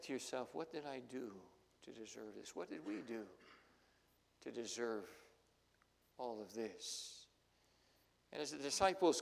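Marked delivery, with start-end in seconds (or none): 0.5-7.3 despairing question. Acted. Parent in despair at hospital.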